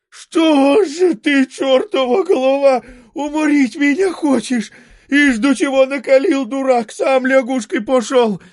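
plaintive yell. A narrator's reading. Merchant wailing at the coachman in the hot steam. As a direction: howl it like he's being cooked alive. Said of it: "орёт плачевно".

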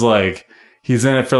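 normal talking voice

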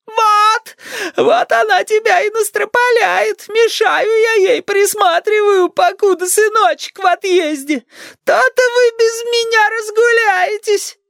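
put-on very high, slow old-woman tirade; high, aggrieved granny voice; bitter grandma. A narrator's reading. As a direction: lament spitefully in a drawn-out granny wail.

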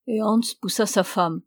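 usual speaking voice

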